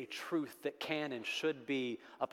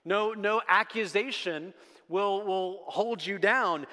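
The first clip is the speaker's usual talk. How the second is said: louder, higher, building preacher's cry; rising preaching; 5 semitones higher, 4 dB louder than usual. Acted. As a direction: preach it with growing force, slowing on the last words. Preaching at full force.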